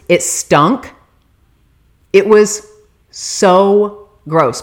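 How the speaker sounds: usual speaking voice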